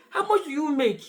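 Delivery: mock interviewer asking in earnest; serious. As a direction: put the question straight, without a giggle. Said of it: "some will be serious".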